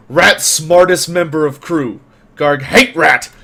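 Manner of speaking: loud, emphatic broken-speech grumbling; resentful brute crewman. Acted. Crewman complaining to the crew about the rat, loud resentment. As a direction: play an aggrieved simpleton, punching the words. Game master voices Garg hating rat.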